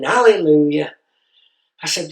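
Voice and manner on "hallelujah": louder, mock-triumphant shout. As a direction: belt it out like a charity finale.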